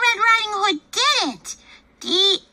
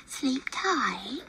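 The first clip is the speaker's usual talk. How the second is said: soft unvoiced good night; whispered good night; 5 semitones lower, 10 dB quieter than usual. Chicken tucking sleeping Papa in, whispering softly. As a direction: whisper so Papa stays asleep.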